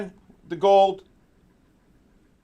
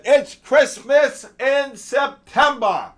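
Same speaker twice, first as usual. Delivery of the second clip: slow, drawn-out and higher, savouring every word; gleeful exclamation; 6 semitones higher, 4 dB louder, much slower than usual. Real, not acted. Streamer gloating to viewers as gold drops, slow drawn-out cheer. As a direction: stretch it out like you can't believe your luck. Celebrating again as gold keeps dropping.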